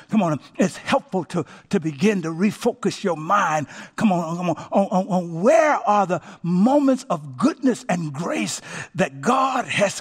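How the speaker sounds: high, urgent sermon delivery; forceful preaching. Real, not acted. Preacher urging congregation to refocus in silence time, fervent. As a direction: drive it home with rising fervor.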